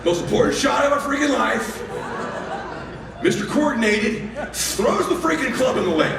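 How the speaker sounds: high, strained comic rant; exasperated outburst. Retold or imitated. Dad after son's club lands in lake, exasperated rant. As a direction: vent in disbelief.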